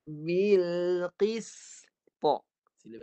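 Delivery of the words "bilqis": Bilqis recited with ta and sin stopped; teacher chants Bilqis with both letters dead; recited demo. Shown as a demonstration. Teacher showing students two dead letters, recited. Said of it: "Dengar saya baca".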